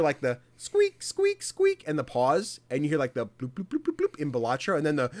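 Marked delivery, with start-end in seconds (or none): 1.0-1.8 high squeaky stair noise; squeak imitation. 3.5-4.2 game sound imitation.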